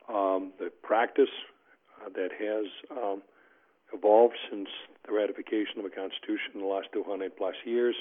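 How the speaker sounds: ordinary delivery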